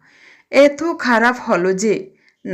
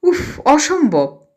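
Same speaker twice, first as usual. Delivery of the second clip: slow, high, drawn-out fussy father; dismayed fussy father, much higher and slow; father refusing; 7 semitones higher, much slower than usual. A narrator's reading. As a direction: stretch out the refusal like a worried old father. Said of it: "বিরিঞ্চির বাবা বললেন".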